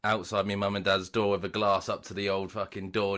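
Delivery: normal talking voice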